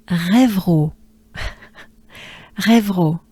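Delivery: Rêveraud with a breathy French R. Shown as a demonstration. Teacher modelling the town name Rêveraud. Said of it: "stay with me".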